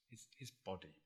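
hushed. Real, not acted. Hushed emphasis on body.

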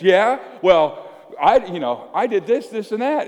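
loud, high, scoffing comeback; one-upping retort. Acted. Another disciple topping his rival, scoffing. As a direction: top him with your own list.

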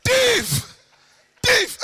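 full-force high yell; piercingly high, very loud cry for help; shouting. Retold or imitated. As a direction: raise the alarm at the top of your voice. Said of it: "I start shouting".

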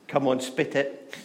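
normal talking voice

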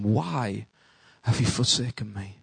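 a broken, hushed plea to the Father; hushed cry. Retold or imitated. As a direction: let the words sink away to almost nothing. Jesus' cry, repeated hushed.

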